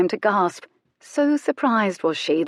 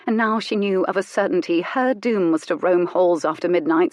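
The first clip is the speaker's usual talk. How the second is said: ordinary delivery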